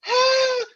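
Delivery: high excited squeal of joy; inner scream. Retold or imitated. Fan squealing inside at the gold record. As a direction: shriek like a starstruck fan. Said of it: "inside, I'm like".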